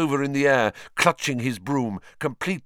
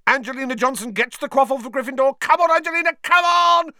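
excited commentator; 13 semitones higher, 5 dB louder, faster than usual. Acted. Commentator cheers on Angelina.

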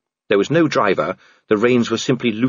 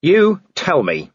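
growled; 9 semitones higher, 3 dB louder, slower than usual. A narrator's reading. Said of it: "he growled".

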